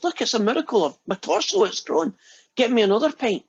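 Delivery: giddy high-pitched disbelief; excited head. Acted. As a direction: squeal it out like a delighted miracle. Head celebrates growing its torso back.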